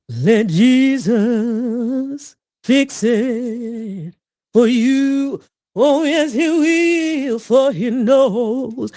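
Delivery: put-on slow, drawn-out, high churchy old woman; old church mother. Playing an old woman on stage, slow church mother. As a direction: preach it slow like a gospel grandma. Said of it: "playing an old woman".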